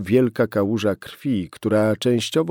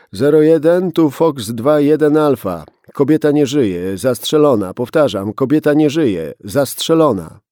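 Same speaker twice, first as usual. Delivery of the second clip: loud, higher, clipped call into the mic; urgent radio report; 4 semitones higher, 9 dB louder than usual. Acted. Policeman radioing headquarters about the body, loud and urgent. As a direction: report the dead woman over the radio, urgent.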